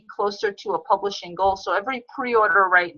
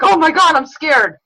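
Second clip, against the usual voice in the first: high, loud burst of pretend fright; hammed-up panic attack, louder and higher; mock panic; 6 semitones higher, 7 dB louder than usual. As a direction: act out an over-the-top panicked plea. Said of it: "giving this kind of panic attack".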